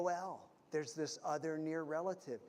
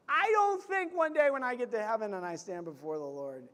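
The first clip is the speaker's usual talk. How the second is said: ordinary talk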